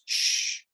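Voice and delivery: acted hush, all breath; hushing "shh". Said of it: "Keep your voice down".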